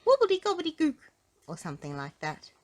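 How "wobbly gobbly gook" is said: high, loud goblin incantation; magic word. A narrator's reading. Goblin shrinking Mister Uppity, high-voiced magic word. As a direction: chant it high as a goblin's spell. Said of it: "he said a magic word".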